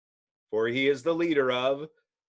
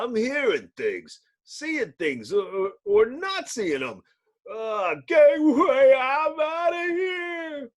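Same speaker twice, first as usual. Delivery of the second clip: high, frantic yelping voice; panicked cabbie; 11 semitones higher than usual. Acted. Cabbie hearing an invisible passenger, high and terrified. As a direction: flee in a squealing panic.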